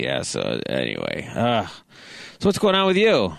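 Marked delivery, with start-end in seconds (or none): none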